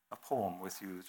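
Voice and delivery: normal talking voice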